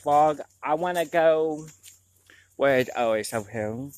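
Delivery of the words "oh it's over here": normal talking voice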